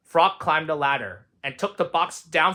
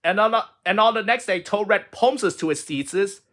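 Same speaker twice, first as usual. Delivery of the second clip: usual speaking voice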